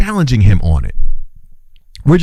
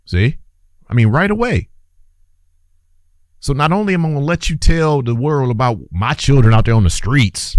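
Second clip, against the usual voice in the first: ordinary talk, nothing marked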